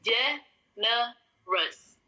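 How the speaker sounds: generous drawn out syllable by syllable as JEN-er-us; slow English generous. Shown as a demonstration. Teacher repeating generous very slowly for the class. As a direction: stretch every sound of generous. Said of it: "Saya ulangi".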